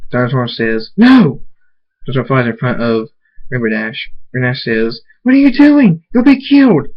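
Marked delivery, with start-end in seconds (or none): 0.9-1.4 high, loud shouted protest; desperate cry. A narrator's reading. Cries out at the attack. 5.2-6.9 very high, loud alarm; panicked shout. A narrator's reading. Panic as he blocks the attack.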